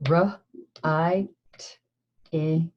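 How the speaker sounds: R, I, T, I, ing, each sound split apart; sounding out words. Shown as a demonstration. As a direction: segment writing into R, I, T, I, ing. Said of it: "Let's do it again".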